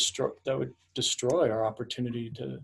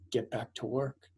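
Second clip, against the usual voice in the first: retold whisper; 7 dB quieter than usual. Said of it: "I'll just whisper in her ear".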